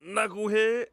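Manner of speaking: high, loud growling impression; Charles Barkley. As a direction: growl into it from the top. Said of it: "you had too much growl".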